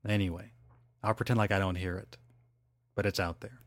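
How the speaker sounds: normal talking voice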